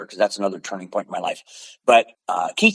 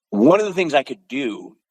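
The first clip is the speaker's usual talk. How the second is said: usual speaking voice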